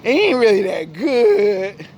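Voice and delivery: high, slow, whiny critic voice; honest listeners. Retold or imitated. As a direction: act out the unimpressed crowd, high and drawn out. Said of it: "majority of people say".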